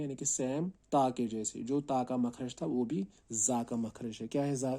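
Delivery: ordinary talk, nothing marked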